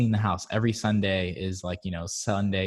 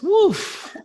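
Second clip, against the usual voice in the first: exasperated exclaim; 8 dB louder than usual. Real, not acted.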